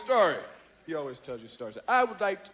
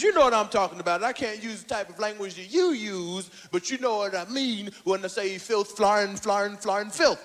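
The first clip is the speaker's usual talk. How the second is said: lower, patient lecturing voice; Bill Cosby impression; 7 semitones lower than usual. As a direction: explain it like a patient dad. Cosby voiced in low tones.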